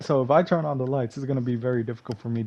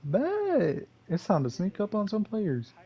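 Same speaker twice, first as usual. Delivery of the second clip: very high, excited squeal; gleeful; 11 semitones higher than usual. Retold or imitated. Gamer thrilled he can camouflage, very high. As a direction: squeal with delight at the new trick. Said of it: "at this point I was like".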